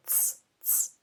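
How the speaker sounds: "Ts" as one voiceless T-plus-S burst; sounds blended. Shown as a demonstration. Teacher demonstrating the letter to learners, sounds blended. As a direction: run T straight into S for "Ts", no vowel. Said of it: "T and S together".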